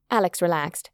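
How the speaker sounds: plain reading voice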